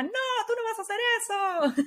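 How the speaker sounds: high-pitched mock scolding mother. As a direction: play the strict mom, shrill and quick. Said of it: "te salió como la".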